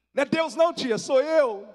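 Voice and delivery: very high, loud mock yell; comic loud protest. Nephew joking to startled aunt, hammed-up loud protest. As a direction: shout the correction for laughs. Replays reply as a joke.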